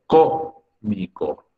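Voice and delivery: cómico very slowly, weight on CÓ; stress on first syllable. Shown as a demonstration. Teacher gives cómico as an example, slow first-syllable stress. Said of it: "sílaba tónica corresponde a la antepenúltima".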